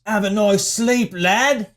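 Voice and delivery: slow British catchphrase delivery; cocky British one-liner imitation; mock British accent. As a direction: deliver a quip in broad British. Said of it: "did you hear his quips".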